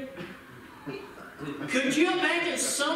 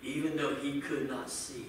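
quiet earnest preaching; 9 semitones lower, 9 dB quieter than usual. Acted. Preacher urging the congregation to seek God: hushed, earnest.

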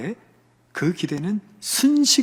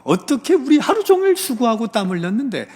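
high, indignant protest, acted out; resentful complaint; 5 semitones higher than usual. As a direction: complain bitterly as a worker wronged by the boss. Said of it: "이렇게 주인께 원망한 거예요".

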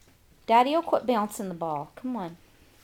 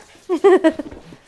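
high laugh; 7 semitones higher, 12 dB louder than usual. Real, not acted. Mother laughs at the dog.

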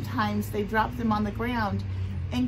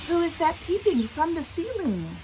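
higher, lilting storybook voice; lullaby reading; 8 semitones higher than usual. Acted. Teacher reading a Thai lullaby to children, lilting. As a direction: read it as a gentle lilting verse. Reads lullaby verse aloud.

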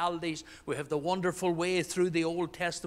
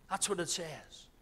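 ordinary talk

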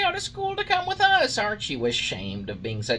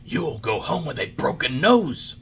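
put-on loud, raised boyish warning; angry threat; 4 semitones higher, 6 dB louder than usual. A narrator's reading. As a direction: threaten the puppet forcefully. Boys threaten mocking puppet.

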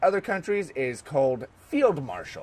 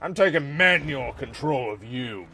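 ordinary talk, nothing marked

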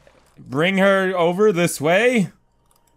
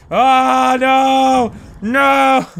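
higher and louder panicked no; alarmed shouts; 7 semitones higher, 5 dB louder, much slower than usual. Real, not acted. Player caught by the monster, alarmed shouting. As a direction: yell in alarm.

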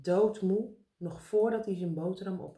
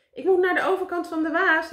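high, loud recital; shouted; 10 semitones higher, 8 dB louder than usual. A narrator's reading. Karel repeats his orders from the air, shouting. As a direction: shout the answer back from afar. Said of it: "riep Karel".

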